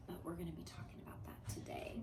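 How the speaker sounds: soft secretive whisper. Real, not acted. Teacher hinting at today's lesson, whispered teaser.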